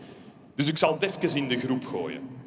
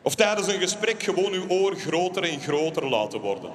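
ordinary talk, nothing marked

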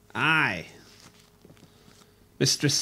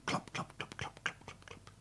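softer and softer whispered clops; fading whispered hoofbeats; 22 dB quieter than usual. Acted. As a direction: let the hooves fade far away.

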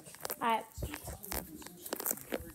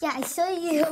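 ordinary talk

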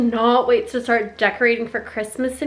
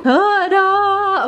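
high, bright ta-da; excited reveal; 9 semitones higher, 4 dB louder than usual. Real, not acted. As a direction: unveil it with a big flourish. Revealing the organized closet.

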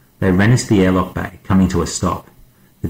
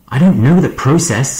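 higher, louder angry retort; heated, raised-voice protest; 7 semitones higher, 5 dB louder than usual. A narrator's reading. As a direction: snap it back in frustration. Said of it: "Stark said angrily".

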